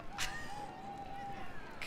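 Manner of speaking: short breathy laugh at the screen; amused laugh. Real, not acted. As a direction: let a real laugh slip out. Streamer laughing at villain.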